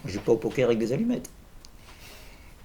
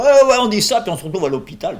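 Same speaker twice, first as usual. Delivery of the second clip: mocking retort; 10 semitones higher, 11 dB louder than usual.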